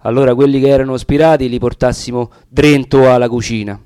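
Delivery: usual speaking voice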